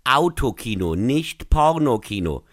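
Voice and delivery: "Autokino" stressed, then "Pornokino" drawn out slowly; emphatic stress. Real, not acted. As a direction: set "Autokino" firmly against a slow "nicht Pornokino". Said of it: "Ich betone".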